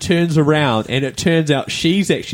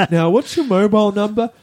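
imitating a clueless clerk, pitched up; lightly put-on airhead, higher voice; ditzy shop worker; 6 semitones higher than usual. Ditzy clerk asking the customer for a number, high-pitched. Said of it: "This is the ditzy one".

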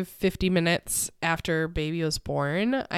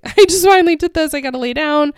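loud, high, fast burst of relief; re-enacted breathless-with-excitement cry; elated outburst; 8 semitones higher, 12 dB louder, faster than usual. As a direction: blurt it out, overjoyed and urgent. Retelling her elated moment after birth.

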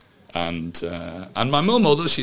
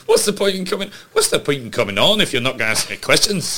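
put-on a light, laughing Scottish accent, a touch higher; Lorraine Kelly's Scottish accent; 4 semitones higher, 5 dB louder than usual. Podcast host mimics Lorraine Kelly scolding a guest, light Scottish accent. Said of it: "second time I've done a Scottish accent".

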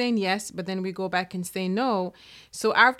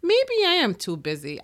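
higher, lightly acted self-persuasion; giving in to doubt; 9 semitones higher, 4 dB louder than usual. You agreeing with your little voice, mock conceding. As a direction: talk yourself out of it, pitched up. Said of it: "you agree with that little voice".

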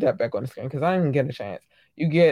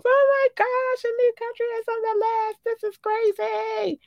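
inner excitement. Acted. Host demonstrating her reaction to a new country, excited squeal. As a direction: squeal each word out in giddy disbelief. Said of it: "that's exactly how it goes".

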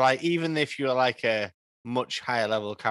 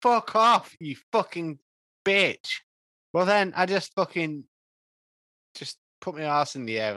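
swearing tirade; 4 semitones higher than usual. Real, not acted. Rolls a miss, rants.

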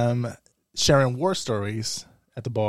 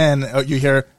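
ordinary talk, nothing marked

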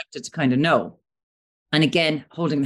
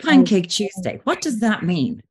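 mock puzzled question; 6 semitones higher, 3 dB louder than usual. Mock question imitating listener.

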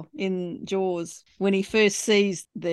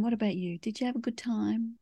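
soft, near-whispered question back to him; quiet flirty quote; 9 dB quieter than usual. Retold or imitated. Cohost quoting Maddie's flirty question, softly. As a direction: hush it, teasing and flirty. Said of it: "I like how she says to him".

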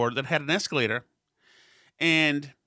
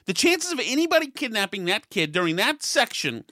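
ordinary delivery

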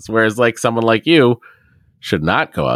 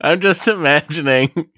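higher-pitched, giggly delivery; laughing; 11 semitones higher than usual. Real, not acted. Cohost caught laughing at the email explains, laughing. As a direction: talk through real laughter. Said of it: "Why are you laughing?".

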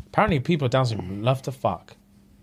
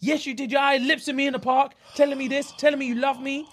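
higher, imitated tearful accusation; mock crying; 10 semitones higher than usual. Retelling a dumped man's breakdown, higher mock tearful voice. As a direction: play his breakdown, half-hearted sob. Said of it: "He's crying his eyes out".

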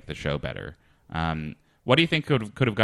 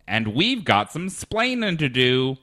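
put-on English accent; 7 semitones higher, 3 dB louder, slower than usual. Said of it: "then an English f-, accent".

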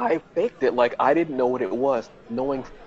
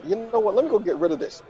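awkward nervous cover; 9 semitones higher than usual. Retold or imitated. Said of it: "so awkward".

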